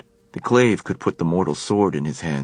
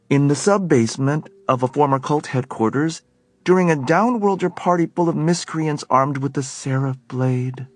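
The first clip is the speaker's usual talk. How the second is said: sharp disbelieving woman's voice, higher; Helen, incredulous; 9 semitones higher, 3 dB louder than usual. A narrator's reading. Helen challenging Alec at the party, incredulous. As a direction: raise her voice in disbelief.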